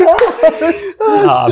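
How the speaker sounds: long high loud laughing fit; real laughter. Real, not acted. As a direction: lose it completely. Laughing at dad quote.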